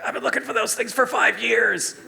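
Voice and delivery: high, loud mock lament; exasperated friend, imitated. Australian collector lamenting a long search, loudly exasperated. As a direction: cry out in exasperation.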